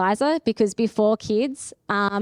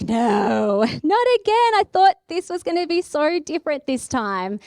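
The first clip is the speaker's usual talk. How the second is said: laughing despair; 6 semitones higher than usual. Retold or imitated. Symptoms returning, retold dismay.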